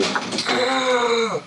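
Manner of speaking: high pained mock scream; imitating the uncle's anguished cry; retold scream of agony. The uncle screaming in agony after the shooting, high cry. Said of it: "He was screaming".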